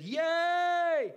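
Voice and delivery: high, loud squeal of joy; excited yay. Retold or imitated. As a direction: shriek it out like a thrilled friend. Reads friend's excited text.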